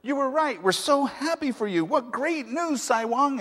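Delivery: high, bright rush of mock joy from the crowd; excited congratulations. Neighbors crowd round to congratulate Sai Wang, excitedly. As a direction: cheer him on like happy neighbors.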